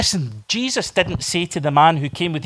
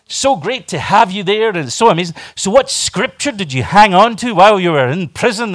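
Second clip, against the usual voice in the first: higher, effusive interviewer voice; gushing interviewer; 7 semitones higher, 7 dB louder than usual. Acted. Interviewer greeting a freed prisoner, gushing. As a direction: welcome the guest with put-on enthusiasm. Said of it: "the guy was like".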